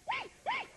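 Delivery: repeated short high shrieks; imitated creature squeal. Showing the noise the thing made, high squeals. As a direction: squeak like a strange little animal. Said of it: "some kind of thing goes".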